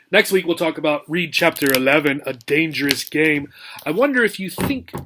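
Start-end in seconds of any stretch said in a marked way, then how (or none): none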